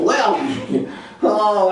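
ordinary talk, nothing marked

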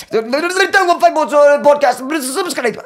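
high-pitched garbled babble with words poking out; squealed gibberish announcement; mock speaking in tongues. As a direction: jabber a podcast plug as if possessed. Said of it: "start speaking in tongues".

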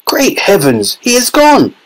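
high-pitched cry of alarm; alarmed shout. A narrator's reading. Father sees his son has vanished, alarmed shout. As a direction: cry out in shock, voice leaping high. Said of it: "shouted Mister T V".

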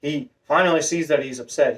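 usual speaking voice

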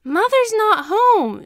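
high, loud, slow sobbing scream. A narrator's reading. Crying boy screams at teacher that mother is gone. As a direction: scream it through tears. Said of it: "Shoto screams".

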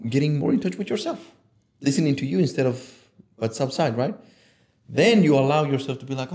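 ordinary delivery

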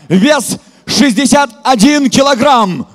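ordinary talk, nothing marked

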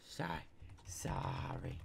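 sheepish whispered apology. Real, not acted. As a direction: shrink down after an outburst. Apologising after the screaming outburst.

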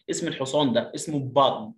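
ordinary delivery

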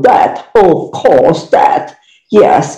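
exaggerated, drawn-out British English accent; slow mock-British accent, stretching each word. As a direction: put on a hammed-up British accent. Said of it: "You don't have to be like an English people".

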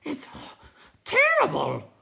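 high, loud gasping outburst with breaks; breathless. Acted. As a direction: gasp between words, then burst out loudly. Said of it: "said the night hob breathlessly".

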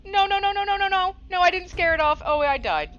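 terrified high, loud screaming; scared horror game streamer. Real, not acted. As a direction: scream as the ghost kills you. Ghost catches and kills her.

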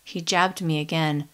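usual speaking voice

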